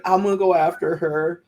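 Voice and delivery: high-pitched mock friend voice; lightly mocking impression of a rescuer; imitated concerned friend. Voices a contestant going after friend.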